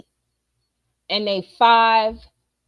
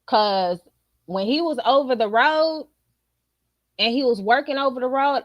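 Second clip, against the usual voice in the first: usual speaking voice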